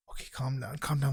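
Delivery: low, soft mutter to himself; hushed self-calming. Retold or imitated. Waiter back from seeing the rapper, hushed and low. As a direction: imitate someone quietly settling his nerves. Acting out a star-struck waiter.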